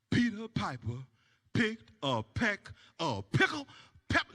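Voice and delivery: low, slow sermon-like drone; preachy friend. Retold or imitated. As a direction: preach at him like a deacon. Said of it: "He kept going".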